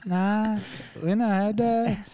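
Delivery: high, drawn-out put-on cook voice; puzzled kitchen cook. Cook answers the waiter about a strange order, high put-on voice. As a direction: stretch it out high, as a baffled cook. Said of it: "And the cook's just like".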